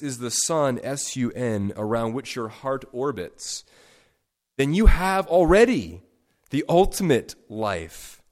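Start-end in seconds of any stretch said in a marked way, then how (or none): 4.6-8.2 emphatic. Real, not acted. Preacher climax.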